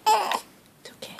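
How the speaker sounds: soothing. Real, not acted. Soothing fussing baby.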